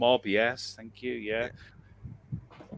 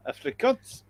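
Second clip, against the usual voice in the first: put-on Afrikaans accent; 11 semitones higher than usual. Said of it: "He could be saying butter".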